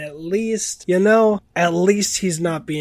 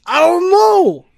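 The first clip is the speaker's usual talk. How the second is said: slow, much higher, louder whiny put-on accent; mock Cockney Chicago; 13 dB louder, slower than usual. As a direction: wail it in the silly accent. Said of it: "That was Cockney Chicago".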